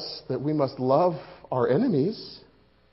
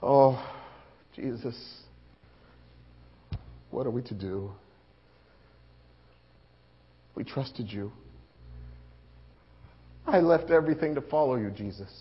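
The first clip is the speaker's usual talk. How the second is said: soft, low and broken; hushed despair; 7 semitones lower, 5 dB quieter than usual. Acted. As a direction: whisper your grief at the cross. Despairing prayer at the cross.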